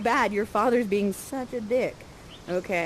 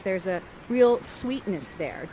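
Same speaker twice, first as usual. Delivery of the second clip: ordinary delivery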